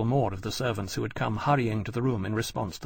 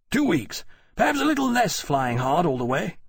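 higher, animated officer's voice; pitched-up character voice, emphatic; Granby's estimate; 6 semitones higher, 3 dB louder than usual. A narrator's reading. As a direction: reckon it up aloud, quick to answer.

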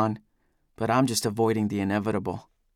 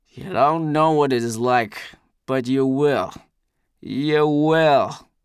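put-on louder, menacing drunken warning; Eldest; 2 semitones higher, 5 dB louder, slower than usual. A narrator's reading. Drunk Eldest warning his accuser, menacing and loud.

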